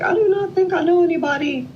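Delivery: very high, quick imitation of disbelief; retold puzzlement. Brother puzzled by a letter from Indiana, mock bafflement. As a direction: play up a baffled brother's protest.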